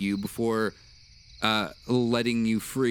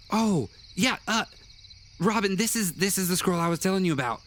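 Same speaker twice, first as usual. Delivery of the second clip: put-on much higher, childlike voice; young boy voice; 9 semitones higher, faster than usual. Boy wizard remembers the scroll, high childlike voice. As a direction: jump up high like a forgetful kid.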